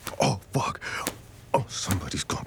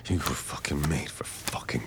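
ordinary talk

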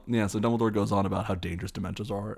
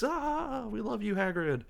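very high mock cheer; cheering crowd; 14 semitones higher, slower than usual. Students applauding Hagrid, high mock cheering. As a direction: fake a distant adoring crowd, pitched high. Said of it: "everyone starts clapping harder".

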